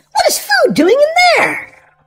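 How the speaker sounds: plain reading voice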